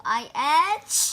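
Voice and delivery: loud acted achoo; sneeze. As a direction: sneeze mid-word. Gerald sneezes mid-sentence.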